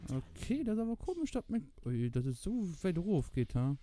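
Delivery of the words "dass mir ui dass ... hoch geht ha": usual speaking voice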